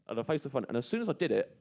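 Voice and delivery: ordinary delivery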